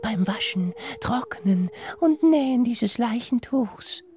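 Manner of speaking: high, slow eerie voice; sing-song like a phantom; otherworldly washerwoman voice; ghostly women. Acted.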